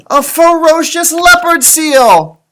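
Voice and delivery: high, loud and slow announcement; dramatic reveal. Pretend. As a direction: unveil the monster with a big mock fright.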